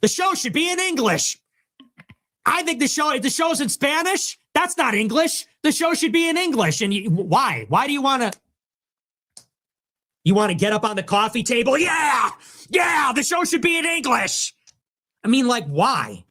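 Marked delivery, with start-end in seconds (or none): 0.0-6.8 high mocking voice of an annoyed fan; mock whiny complainer. Mocking the fans who demand English, in a high whine. 11.8-14.5 high yelled cheer; mock cheering shout. Mocking a fan standing on a table, high shouted cheer.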